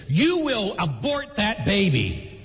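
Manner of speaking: slow, high-pitched mock command; stern ultimatum. Mother ordering her son about the baby, stern ultimatum.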